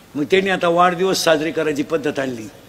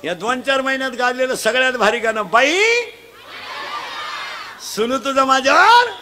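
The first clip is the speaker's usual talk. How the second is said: full-force preaching; 6 semitones higher than usual. Acted. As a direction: drive it home to the crowd. Quizzing the crowd loudly.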